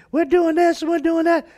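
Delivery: high, loud caricature of a boastful pastor; mock eager pastor. The pastor boasting to the caller, high-pitched mocking voice. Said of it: "he went off and said".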